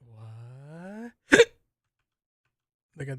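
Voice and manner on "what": ordinary talk, nothing marked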